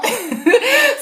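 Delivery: high, short laugh. Real, not acted. Host admitting they made up questions, high laugh. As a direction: laugh at yourself briefly.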